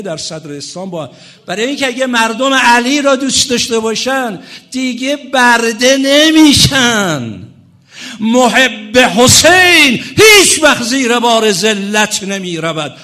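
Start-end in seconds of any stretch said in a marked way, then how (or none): 1.5-12.9 high, shouted sermon climax; full-force preaching. Real, not acted. Preacher proclaiming that lovers of Hussein refuse humiliation, impassioned shout. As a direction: proclaim it to the crowd, stretching the words loudly.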